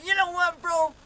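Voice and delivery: high, very loud heckler's yell; mock a stranger's full-volume heckle; imitated yelling. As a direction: holler up at a painter on a ladder.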